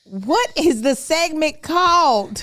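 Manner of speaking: high, loud, slowly drawn-out demand; mock exasperation. Host quizzing co-host on segment name, mock exasperated. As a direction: scold him in hammed-up exasperation.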